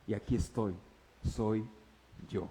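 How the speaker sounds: whisper-close revelation of God; hushed divine voice. Acted. Dios revelándose. (voz de Dios, baja y suave)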